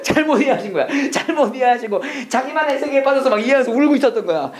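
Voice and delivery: 웃음 섞인 높은 목소리; 웃음을 못 참고 높은 톤으로 이야기; 웃으며 말하기. Real, not acted. 우스운 일화에 웃음이 터짐. (진짜 웃음이 터진 채로)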